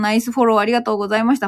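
quick hurried thanks; fast, tossed-off thanks; rushing before cutoff. Real, not acted. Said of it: "ちょっと駆け足でごめんなさいね".

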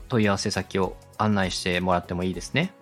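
ordinary talk

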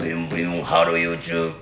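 low beatbox-style YouTuber catchphrase; Hikakin. Shown as a demonstration. Comedian showing his one good impression to his partner, low beatboxed catchphrase. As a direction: buzz it out deep like voice percussion. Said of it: "ボイパだから。".

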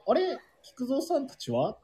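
high-pitched imitation of a puzzled arrival; puzzled visitor. Imitating a regular who turned up to find his friends missing, puzzled. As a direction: act out arriving and wondering where everyone is. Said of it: "カリビアンさん来られて".

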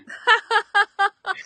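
bursting out laughing; big happy laugh; loud laugh. Real, not acted. Laughing at own excitement.

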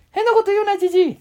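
put-on high boy voice shouting; high puppet boy yelling at grandpa; Ken-chan yelling. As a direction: yell it high and cheeky like a little boy. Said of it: "またじじいって言ってるでしょ".

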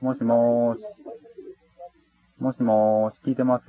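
drawn-out, low hello down the line; teasing singsong phone hello; taunting call-out. Real, not acted. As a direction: prod the silent listener with long lazy vowels. Taunting calls to a silent line.

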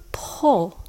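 "pull" as a short, reduced ending; reduced syllable. Shown as a demonstration. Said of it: "Pal becomes".

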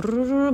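ルルルル as a rapid rolled-R burst; rolled R trill. Shown as a demonstration. Showing how Tamil speakers sound, rolled-tongue trill. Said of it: "巻き舌風な感じの英語".

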